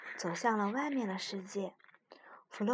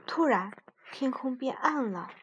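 usual speaking voice

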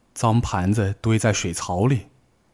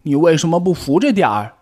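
higher, louder, fast blaming rabbit; reproachful; 8 semitones higher, 6 dB louder, faster than usual. Acted. Tiaotiao to Bengbeng, fast and reproachful blame. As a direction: snap the blame at him. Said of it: "跳跳责怪地看着蹦蹦".

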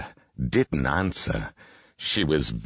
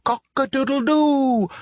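very high, loud cock-a-doodle-doo; rooster's crow; 5 dB louder than usual. A narrator's reading. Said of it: "crowed the rooster".

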